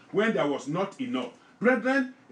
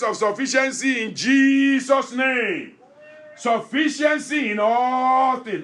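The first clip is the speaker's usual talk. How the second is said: louder, proclaiming the blessing with full force; forceful preaching blessing; 5 semitones higher, 5 dB louder than usual. Acted. Preacher blessing his congregation, loud and proclaiming. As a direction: pronounce it over them like a decree.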